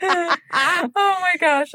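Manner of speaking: high, drawn-out and weak with laughter; laughed-out. Real, not acted. Recovering from the baptism joke, laughed-out. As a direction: sigh it out after cracking up.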